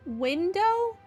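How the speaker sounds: ordinary talk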